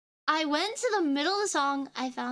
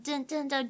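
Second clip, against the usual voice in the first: imitating ringtone. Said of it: "Nokia ringtone".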